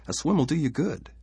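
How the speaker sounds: high-pitched, persuasive coaxing; coaxing wife. Acted. Wife to husband refusing a swim, coaxing.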